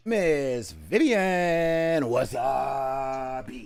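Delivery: slow, shouted name call like a ring announcer; announcer intro. Acted. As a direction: stretch out the name to present her. Announcing the next player.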